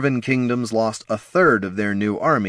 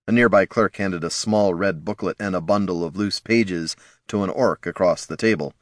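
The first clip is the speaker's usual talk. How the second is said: ordinary delivery, nothing marked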